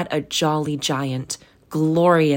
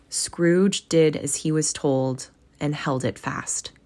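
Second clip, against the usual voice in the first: usual speaking voice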